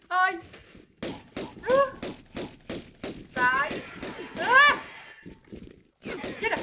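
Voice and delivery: loud panicked yelps; startled screams. Real, not acted. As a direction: shriek at something on you. Startled by something, shouting.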